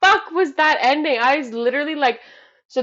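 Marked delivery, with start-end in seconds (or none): 0.0-1.2 higher, louder stunned outburst; retold disbelief. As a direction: exclaim it like the credits just rolled. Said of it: "I was so disappointed 'cause I was like".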